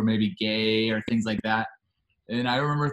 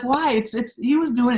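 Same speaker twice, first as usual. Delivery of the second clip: high, loud mock protest; imitated protesting girlfriend; 12 semitones higher, 5 dB louder than usual. Girlfriend defending herself to coworkers, high mock protest. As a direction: plead her case in a raised imitation. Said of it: "And she's like".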